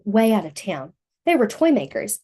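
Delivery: ordinary delivery, nothing marked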